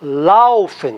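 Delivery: Laufen, weight on Lauf; stress on first syllable. Shown as a demonstration. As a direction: hit the Lau of Laufen and let the ending go weak. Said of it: "tá no lauf".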